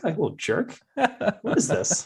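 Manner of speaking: high, incredulous mock outrage; playfully affronted, voice jumping up; mock indignation. Dad robbed of balls by toddler, mock indignant.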